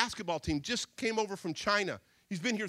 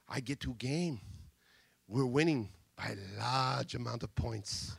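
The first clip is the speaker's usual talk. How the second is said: broken English; 11 semitones lower, 4 dB quieter than usual. Retold or imitated. Boy retelling the game in a Chinese accent. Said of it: "speaks broken English".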